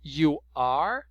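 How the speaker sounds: normal talking voice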